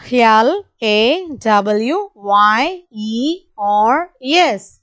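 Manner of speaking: each letter slow and spaced; slow spelling. Shown as a demonstration. Teacher spelling slowly for the class. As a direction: spell slowly so kids can follow. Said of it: "repeat along with me".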